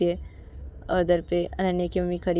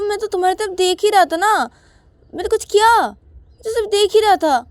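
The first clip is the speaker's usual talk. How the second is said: much higher, louder indignant retort; defiant child; 11 dB louder than usual. Acted. Ananya to mother and Payal, loudly defiant. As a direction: argue back, outraged. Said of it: "तो तभी अनन्या बोलती कि".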